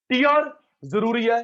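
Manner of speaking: high, loud teacher call. Shown as a demonstration. Teacher calls out to online students, loud. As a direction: call it out to the class. Teacher calling out answer loudly.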